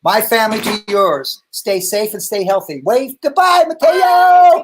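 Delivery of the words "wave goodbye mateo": loud, high cheery shout; excited urging. Real, not acted. Dad loudly urges his son to wave goodbye to viewers.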